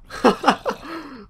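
high gleeful laugh. Real, not acted. Player laughing at the thwarted creeper, high gleeful laugh. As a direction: laugh with gloating glee.

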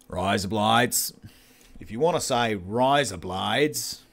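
slow, drawn-out Australian vowels; Australian accent. Shown as a demonstration. Showing viewers an Australian accent, slow and exaggerated. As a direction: put on a slow Aussie accent. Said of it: "in an Australian accent".

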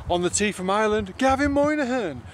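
announcer call. Shown as a demonstration. Practising starter announcement.

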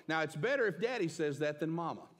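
ordinary talk, nothing marked

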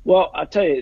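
usual speaking voice

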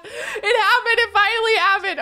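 high, loud, laughing and near tears; laughing with joy. Real, not acted. Player laughing and near tears after the long wait. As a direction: laugh through happy tears.

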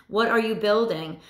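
ordinary talk, nothing marked

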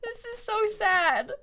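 high, slow pouty whine; mock sad. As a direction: whimper it like a sulky kid. Out of bombs.

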